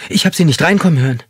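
high, fast startled gasp of words; startled by the man leaning over her, blurted fast and high. A narrator's reading. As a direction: blurt it out in fright. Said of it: "entfuhr es ihr".

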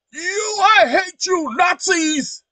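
imitating a screaming protester, very high and loud; mock screamed insult; mock yell. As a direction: hurl it like an angry heckler. Acting out people yelling profanities.

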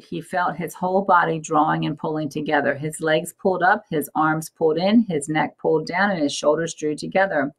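ordinary delivery

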